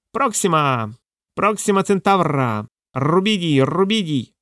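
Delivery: high, drawn-out parrot squawk; distinct parrot cry. A narrator's reading. Parrot on the scales cries out, squawking. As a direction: squawk each word out distinctly. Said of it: "виразно крикнув".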